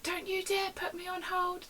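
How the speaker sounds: high-pitched mock outrage; hammed-up indignant warning; angry caller. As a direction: imitate an indignant customer forbidding it. Angry caller forbids being put on hold.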